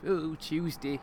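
understated mock Peterborough accent. Shown as a demonstration. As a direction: try a light Peterborough accent. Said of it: "stick a Peterborough accent in then".

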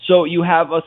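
usual speaking voice

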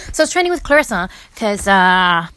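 normal talking voice

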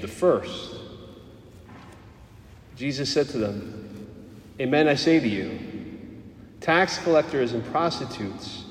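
measured, formal scripture proclamation; gospel reading. Acted. Priest reading the Gospel at Mass, solemn formal proclamation. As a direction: read the Gospel aloud to the congregation.

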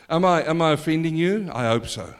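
usual speaking voice

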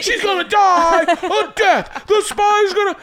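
very high screamed outrage; shrieking stand-up rant; Sam Kinison. Acted. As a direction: scream it in disbelief, stretched out. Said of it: "Sam Kinison".

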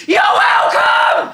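high, drawn-out sarcastic yell; loud, aggressive shout at an ungrateful driver. Acted. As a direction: bellow it out of a car window. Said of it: "I'll shout".

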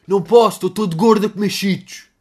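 comic shouted excuse; fat kid Ivo. Acted. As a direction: play a lazy kid shouting back a refusal. Acts Ivo replying.

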